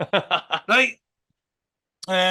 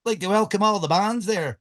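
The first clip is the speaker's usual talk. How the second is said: put-on Ulster accent. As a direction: mimic a radio host's Ulster accent. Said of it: "I do a good Ulster accent".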